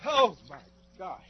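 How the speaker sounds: gasping after icy soak; stunned by the freezing water; in ice cold water. Real, not acted. Just soaked in ice water.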